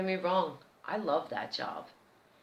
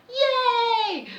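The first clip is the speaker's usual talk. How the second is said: cheering band; 10 dB louder than usual. Retold or imitated. Band cheers her arrival.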